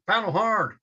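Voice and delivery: full-throated shout over roaring water; yelled. Retold or imitated. Storyteller shouting to April over the falls, loud urgent yell. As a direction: yell it hard at your partner. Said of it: "And I yelled".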